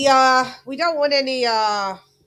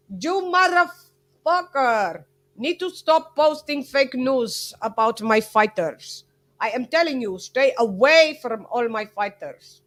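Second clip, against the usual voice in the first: Klimas. Retold or imitated. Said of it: "And then he said over here".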